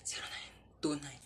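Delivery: whispered phone number. Acted. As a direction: breathe the digits under your breath.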